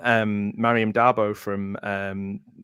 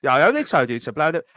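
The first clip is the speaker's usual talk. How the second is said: a hint of the young Caine, a bit higher and louder; young Michael Caine; 5 semitones higher, 4 dB louder than usual. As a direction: lightly mimic young Caine's brash line. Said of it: "there's Michael Caine when he's young".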